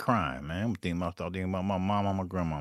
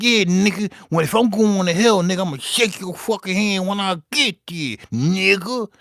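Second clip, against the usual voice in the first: loud, higher cocky repeat; hyped retelling of the comeback; defiant repeat; 10 semitones higher, 7 dB louder than usual. Retold or imitated. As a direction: lean in and say it again with swagger. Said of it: "I said".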